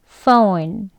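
"phone" with a clear F, ph said as F, rising; teacher modelling "phone" with an F; F sound demo. Shown as a demonstration. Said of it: "F 하고 시작합니다".

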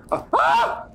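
sudden high scared yelp; startled yelp. Real, not acted. Jump scare in game.

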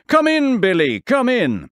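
high, loud opening that falls away; eager welcome. A narrator's reading. Inventor invites his young neighbour inside, calling eagerly. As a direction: beckon someone in with excitement. Inventor calls boy into shed.

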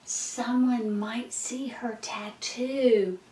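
hushed, secretive whisper; breath-only confiding voice; worried whisper. Acted. Worried secret about the tattoo.

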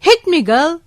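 lightly voiced feisty old lady; Angela. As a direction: imitate a granny daring someone to punch. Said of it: "त्या हात पुढे करून म्हणाल्या".

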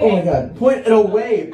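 loud, high, grossed-out cry; disgusted shout. Acted. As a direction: yell it, repulsed. Disgusted friend demanding he turn.

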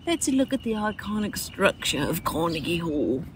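slow British teacher voice; mock British. Mocking co-host's excitement about the hall, slow mock British. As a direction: mimic co-host in a drawn-out British accent. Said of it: "Why do I make you British?".

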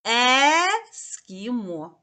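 "Ескімо" said very slowly, the "Е" drawn out and loud; stretched out clearly. Shown as a demonstration. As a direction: draw out "Ескімо" with a strong "Е". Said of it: "голосно, виразно промовимо звук Е". («Ескімо» повільно, з протяжним «Е»)

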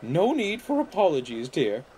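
high, lilting character voice; kindly old car. A narrator's reading. Caroline reassuring apologetic Mavis, kindly old car.

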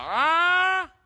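high, loud challenging "ah?"; loud call. Real, not acted. Streamer calls out at police patrols outside, high and loud. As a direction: shout it out at the patrol.

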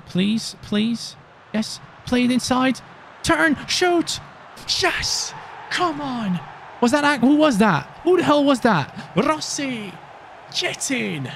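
high, pleading then shouting; yelling at the match; frantic live cheering. Real, not acted. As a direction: urge every touch toward goal. Goal chance and scramble live.

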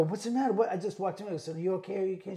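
worried questioning. Retold or imitated. Storyteller checking on a distressed woman, worried.

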